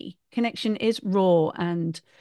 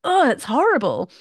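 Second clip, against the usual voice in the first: put-on disgusted teenager; 5 semitones higher, 4 dB louder than usual. Teenager reacts to mum's voice.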